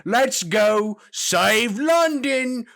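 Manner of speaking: high, loud, slow mock British accent; mock British hero line. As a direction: deliver it like a corny British game hero. Mocking British game dialogue.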